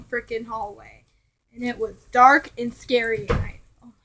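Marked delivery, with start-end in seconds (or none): 3.8-3.9 startled. Real, not acted. Real scare from door slam.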